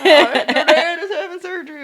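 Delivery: hammed-up old country man, loud, laughing through it. Mock old father telling his daughter about surgery, laughing. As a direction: play the little country grandpa breaking the news. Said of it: "He was a little country old man".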